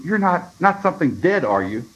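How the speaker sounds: put-on high, alarmed frightened boy; almost a scream. A narrator's reading. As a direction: voice jumps up in dread, stumbling on "not". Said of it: "said almost in a scream".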